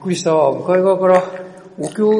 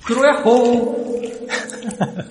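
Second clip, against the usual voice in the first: long slow yoo-hoo into the echo; cathedral echo; 6 semitones higher, much slower than usual. Shown as a demonstration. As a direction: call out to hear it ring back. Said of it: "響くね、声。".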